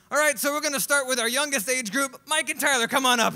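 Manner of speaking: loud, high ringside announcement; a trainer booming out names; announcer call-up. Retold or imitated. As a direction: call the fighters up to the ring. Trainer calling kids into ring.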